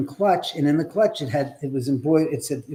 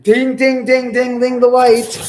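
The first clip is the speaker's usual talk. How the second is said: ding ding called out high and loud; excited chant, higher and louder, slowing at the end; 8 semitones higher, 9 dB louder, slower than usual. Real, not acted. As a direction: sing out the dings as the light flashes. Flashlight flicking on again.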